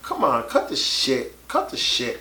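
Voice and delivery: normal talking voice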